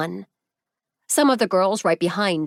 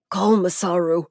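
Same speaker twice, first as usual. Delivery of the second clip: voiced like an injured man forcing out words; strained, injured. A narrator's reading. A hurt man strains to call his friend, lightly strained. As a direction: force the words out through pain. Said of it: "he managed to say".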